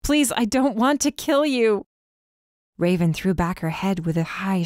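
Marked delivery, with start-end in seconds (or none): 0.0-1.8 much higher, "Please" stressed and stretched; desperate plea. Acted. Captive pleading she does not want to kill, high desperate "Please". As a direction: cry out "Please" high and long, begging. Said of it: "I said through my swollen and split lips".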